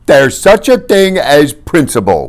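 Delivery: loud, slow indignant retort; drawn-out wounded rebuke; offended Fezzik. A narrator's reading. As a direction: stand on principle, high and loud.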